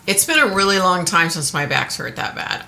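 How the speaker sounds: usual speaking voice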